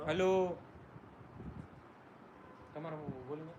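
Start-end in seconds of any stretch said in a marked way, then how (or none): 0.1-0.6 loud mic test hello; louder hello. Real, not acted. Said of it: "આવું ધીમું આવે".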